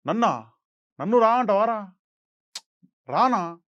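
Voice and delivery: loud, affronted star retort; acted film-hero comeback with long pauses; Pawan Kalyan reaction. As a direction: throw the word back in short, indignant bursts. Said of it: "ఆయన reaction చూడు మళ్ళా".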